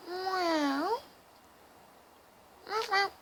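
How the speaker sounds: high little meows aimed at the cat; imitating a cat. Meowing at her cat.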